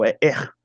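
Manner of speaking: R with the German guttural sound. Shown as a demonstration. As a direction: force R back in the throat, German style. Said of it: "Você tem que fazer essa força".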